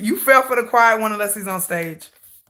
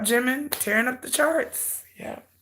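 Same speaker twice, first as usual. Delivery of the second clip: normal talking voice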